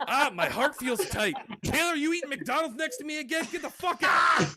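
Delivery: high complaint building to a yell; hammed-up indignation, ending in a shout; mock outrage. Acted complaint about secondhand cholesterol.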